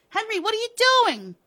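very high, loud, fast cry; shrill startled protest; alarmed shriek. Acted. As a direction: shriek it in panic as you are grabbed. Wilma is being grabbed.

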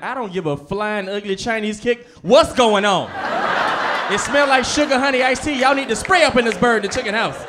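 loud, high shouting mom; hammed-up furious mom; mom yelling. Mom shouting clean curses at everyone, loud and high. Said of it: "she'd be like".

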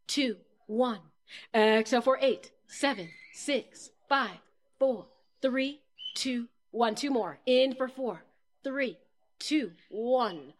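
slow count, each number falling, with pauses; measured breathing-exercise countdown; guided breathing count. Shown as a demonstration. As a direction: pace the group's breath, number by number.

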